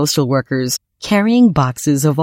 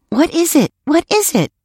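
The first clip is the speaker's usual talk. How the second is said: put-on high-pitched, eager little boy asking; excited child; 13 semitones higher than usual. PJ to his uncle about the special gift, excited.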